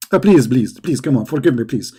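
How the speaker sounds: rapid string of mock pleas; lightly acted begging; mock pleading. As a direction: beg in a hammed-up way. Acting a prophet begging God.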